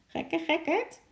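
very high, crowing parrot voice. A narrator's reading. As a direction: crow it like a gleeful parrot, rising at the end. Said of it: "kraaide Lorre". (hoog kraaiende papegaai)

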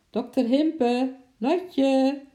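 loud, high, slow calls across the zoo; calling out loud. Acted. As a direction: shout the names as loud as you can. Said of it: "riep Bart zo hard als hij kon".

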